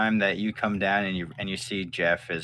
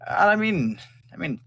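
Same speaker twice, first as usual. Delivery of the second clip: higher, halting, unsure yes; nervous hesitant agreement; 8 semitones higher, 4 dB louder, slower than usual. Acted. Thalen awkwardly agreeing with the boy's question. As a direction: hedge nervously, unwilling to commit.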